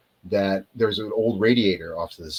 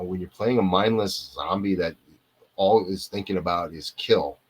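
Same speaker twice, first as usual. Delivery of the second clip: ordinary delivery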